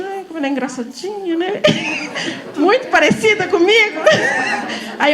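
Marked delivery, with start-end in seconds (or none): 0.0-1.6 mock sweet remark. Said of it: "eu falo assim". 1.7-2.5 a quick high giggle. Real, not acted. Teacher laughing at her own joke, high giggle.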